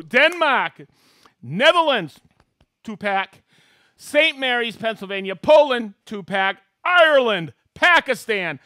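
loud, high announcer shout-outs; excited host roll call. Acted. Host hailing viewers watching from around the world, excited roll call. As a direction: call out each place like a rowdy emcee.

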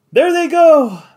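breathless, lifting the words up high; panting. A narrator's reading. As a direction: gasp it out, out of breath. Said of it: "panted Joe".

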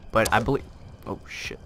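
ordinary talk, nothing marked

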